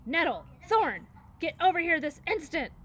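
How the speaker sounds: mother bear calling her fighting cubs to heel; commanding shout. A narrator's reading. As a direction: bellow for the cubs to come now.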